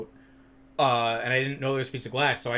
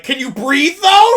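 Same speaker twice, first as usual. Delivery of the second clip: incredulous squeal; 14 semitones higher, 19 dB louder, slower than usual. Retold or imitated. Laughing disbelief at friend.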